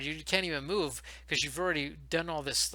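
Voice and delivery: normal talking voice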